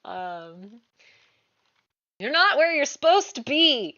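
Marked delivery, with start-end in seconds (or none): 2.2-4.0 loud, high voice ringing in their heads; a loud high voice inside the party's heads; booming voice. Acted. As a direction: boom it into every mind at once.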